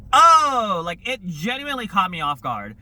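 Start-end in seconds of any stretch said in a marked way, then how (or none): 0.1-0.8 sudden loud high gasp of surprise; surprised. Retold or imitated. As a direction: blurt it out, caught off guard. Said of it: "I was like".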